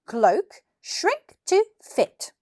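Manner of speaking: slow, high, grand spell-casting; commanding spell. Acted. Billy casting a spell on his cloak, commanding.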